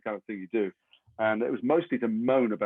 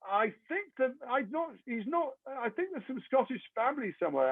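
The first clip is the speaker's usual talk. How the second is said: hedging agent; 12 semitones higher, 4 dB quieter than usual. Retold or imitated. Agent fudging an answer to casting director, high and hesitant. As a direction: stall while making it up.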